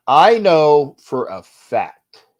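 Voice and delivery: slow loud emphasis; emphatic. Shown as a demonstration. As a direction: lean hard on each word.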